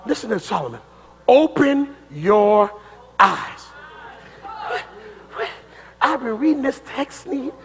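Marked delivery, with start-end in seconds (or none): 1.3-3.7 weighty, deliberate scripture quote; solemn quote. Acted. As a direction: spell it out slowly, word by word. Quotes Solomon's prayer solemnly, slowly.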